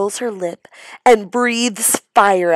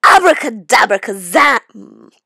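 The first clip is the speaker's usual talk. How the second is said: spell incantation; 3 semitones higher, 9 dB louder than usual. Acted. Witch casting a spell.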